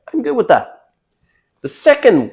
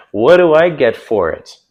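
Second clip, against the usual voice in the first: louder smiling repeat; smiling tease; 3 semitones higher, 6 dB louder than usual. Shown as a demonstration. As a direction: say it grinning. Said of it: "with a smile on my face".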